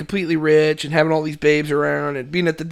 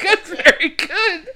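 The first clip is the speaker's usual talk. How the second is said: high, slow, laughing mock praise; 12 semitones higher, much slower than usual. Ted praising Jack, laughing through it. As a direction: praise him while cracking up.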